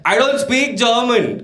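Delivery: high, slow mock yell; yelling back. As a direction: yell it slowly as if at a stranger. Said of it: "So then I yelled back".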